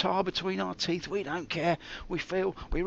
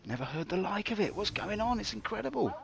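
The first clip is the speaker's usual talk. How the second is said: ordinary delivery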